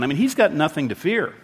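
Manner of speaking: normal talking voice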